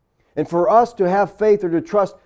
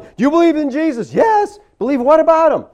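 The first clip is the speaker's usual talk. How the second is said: higher and louder mocking questioner; mock altar call; 8 semitones higher, 5 dB louder than usual. Preacher mocking an altar call exchange, higher and louder. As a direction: lampoon a shallow altar call.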